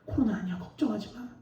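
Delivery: soft, hushed answer to a worrier; God's quiet reassurance. Retold or imitated. God answering the fevered pastor not to worry, softly reassuring. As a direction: drop the volume and gently reassure. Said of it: "하나님 제 속에 말씀하셨어요".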